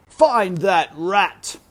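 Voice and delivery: slow, loud, furious royal bellow; king's shout. A narrator's reading. Grieving king shouts at his court, loud and slow. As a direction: roar it out as the enraged king. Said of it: "shouted the king".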